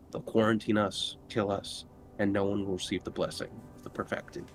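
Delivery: ordinary talk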